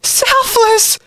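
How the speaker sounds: very high, outraged cry. A narrator's reading. Sister challenges her brother from the well, crying out. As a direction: throw the word back in outrage. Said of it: "cried Allison".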